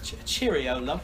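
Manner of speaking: lightly mocking British accent. Friend echoing the cheerio joke, light mock British accent. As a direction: toss off a joking British accent. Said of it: "if I had a British accent".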